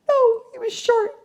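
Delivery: high mock young woman; imitating the young woman. Imitating the woman correcting the psychic, high mock voice. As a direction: answer in a high put-on girlish voice. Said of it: "She's all".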